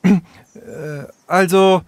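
halting, high false start; awkward hesitation. Acted. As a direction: stall before an apology. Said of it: "Sarah räusperte sich". (verlegen stockend)

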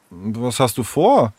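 slow, mildly uneasy question; a touch of concern; worried. Acted. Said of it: "fragte Henry besorgt".

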